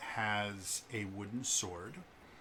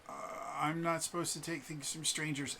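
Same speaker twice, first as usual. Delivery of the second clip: put-on higher-pitched child's voice at ordinary volume; wary little kid; 6 semitones higher than usual. Kid to Vala offering a mask, shyly refusing. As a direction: recite it like a small child turning down a gift. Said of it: "and he goes".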